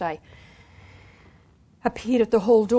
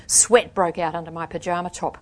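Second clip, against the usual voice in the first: plain reading voice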